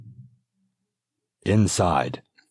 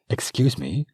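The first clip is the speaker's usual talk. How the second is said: polite interruption; 9 semitones higher than usual. Acted. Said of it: "she said again".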